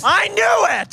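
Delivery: high-pitched yell of vindication; triumphant shout. Real, not acted. Host hears guest pick Wendy Williams, triumphant shout. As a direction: shout it like you've won the bet.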